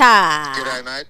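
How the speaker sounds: hammed-up Australian accent. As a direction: fake Aussie g'day greeting. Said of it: "still holding up the fake accent".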